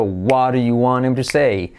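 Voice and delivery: "want him to say" as "wanem-re-say"; slow, fully linked "waru you wanem"; soft R reduction. Shown as a demonstration. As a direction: tap an r in place of the t in "to". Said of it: "se puede convertir en una R suave".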